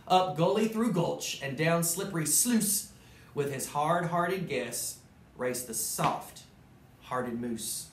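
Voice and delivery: lively storybook narration; animated read-aloud; reading to children. A narrator's reading. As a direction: tell it like a bedtime story to kids. Reads Dr. Seuss story aloud.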